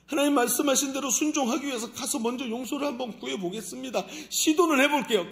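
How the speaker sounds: high, loud determined promise; loud earnest vow. Acted. Believer vows to God, loud and determined. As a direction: declare it boldly to God.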